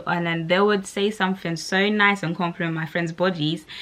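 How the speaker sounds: ordinary talk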